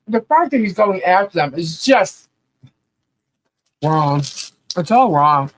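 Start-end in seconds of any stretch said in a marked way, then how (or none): none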